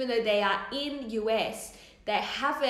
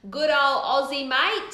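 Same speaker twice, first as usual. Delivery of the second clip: louder, higher, broad Aussie accent; 4 semitones higher, 7 dB louder than usual. Shown as a demonstration. Streamer telling viewers where she's from, hammed-up Aussie accent. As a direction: ham up the Aussie twang. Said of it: "that stereotypical Aussie accent".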